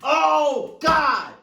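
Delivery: very high, loud wail; piercing shocked outburst; horrified cry. Real, not acted. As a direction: cry out in real horror. Reacting in horror to footage.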